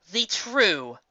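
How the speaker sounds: ordinary delivery, nothing marked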